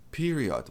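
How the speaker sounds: PERIOD ending in a full "od"; wrong O in period. Shown as a demonstration. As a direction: sound the O of "period" as in "odd". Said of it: "tem um O aqui no final".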